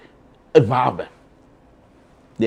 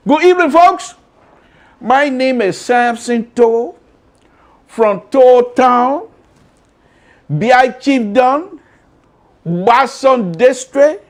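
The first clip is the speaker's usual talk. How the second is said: higher, slow, showman announcer voice; announcer intro; 9 semitones higher, 4 dB louder, slower than usual. Acted. Host introduces himself to viewers like an announcer. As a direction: stretch each place name like a big reveal. Hyped self-introduction on his show.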